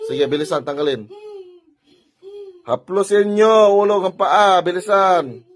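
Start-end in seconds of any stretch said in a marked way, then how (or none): 2.7-5.4 slow, loud, high drawn-out order; shouted command. Real, not acted. Healer ordering spirits to stroke head to foot, shouted command. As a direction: stretch every word into a raised-voice command.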